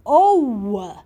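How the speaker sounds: "O" drawn out as a long oh; long O sound. Shown as a demonstration. Teacher showing kids the oa sound. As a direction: stretch "O" for the class to copy. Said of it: "O and A together sound like".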